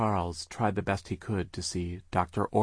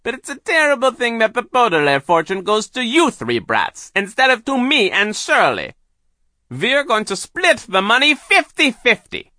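very high, loud villainous rant; Doctor Orwell; 14 dB louder than usual. A narrator's reading. Hypnotist reveals the fortune scheme, high loud villain voice. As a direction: declare the scheme loudly and high. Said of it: "Doctor Orwell said".

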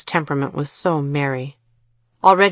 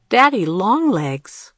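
slow, higher, puzzled repeat; amused disbelief; 5 semitones higher, 3 dB louder, slower than usual. A narrator's reading. Girl repeats the boy's strange name, amused and surprised. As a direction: savour the odd name as a surprised question. Kira laughed.

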